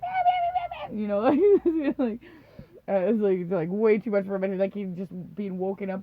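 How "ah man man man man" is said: very high squealy dog voice; squealing dog. Retold or imitated. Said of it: "she was probably like".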